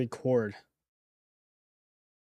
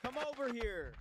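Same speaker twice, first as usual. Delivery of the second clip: higher, soft come over here; quiet higher-pitched beckoning; soft higher call; 8 semitones higher, 19 dB quieter than usual. Shown as a demonstration. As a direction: call someone over softly. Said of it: "How am I supposed to make this sound right?".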